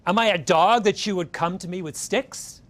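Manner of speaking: put-on a giant's insulted retort to the shepherd boy. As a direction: bristle with wounded pride. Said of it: "he's insulted".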